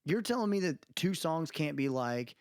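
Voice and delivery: ordinary talk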